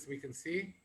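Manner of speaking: ordinary talk, nothing marked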